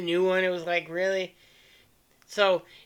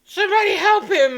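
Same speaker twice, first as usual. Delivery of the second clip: panicked nurse; 11 semitones higher, 4 dB louder than usual. Retold or imitated. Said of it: "the nurse is like".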